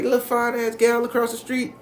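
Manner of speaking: high, loud exclamation of approval; excited grandma. Retold or imitated. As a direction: imitate grandma crowing about the pretty neighbor. Grandma exclaims over the woman.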